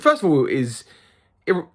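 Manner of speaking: ordinary delivery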